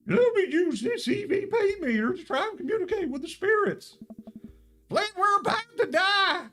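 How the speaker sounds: panicked cartoon Southern ghost-hunter trucker. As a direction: yell in a goofy Southern accent as the truck slides. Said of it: "what is this accent?".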